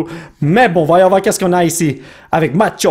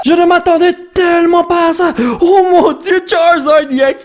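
thrilled shout; 10 semitones higher than usual. Real, not acted. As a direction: still buzzing from the pull. Still thrilled by Charizard. (exclamation emballée, plus aiguë)

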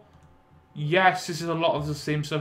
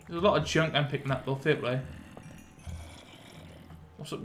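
ordinary talk, nothing marked

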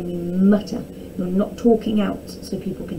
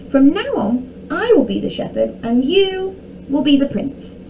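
high, proud announcing princess; 6 semitones higher, 3 dB louder than usual. A narrator's reading. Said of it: "announced Princess Arabella".

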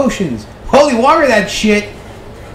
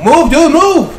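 yelling at teammate to move; urgent shout; 9 semitones higher, 4 dB louder, much slower than usual. Real, not acted. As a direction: shout it, pushing them on. Urging teammate mid-game.

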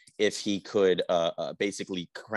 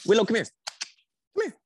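calling the dog; 13 semitones higher, 4 dB louder, much faster than usual. Real, not acted. Calling her dog over.

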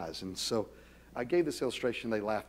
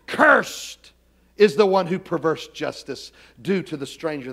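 forceful scripture reading; 4 semitones higher, 6 dB louder than usual. Acted. Pastor proclaiming Deuteronomy's curse to the congregation. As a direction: thunder the verse like God's decree.

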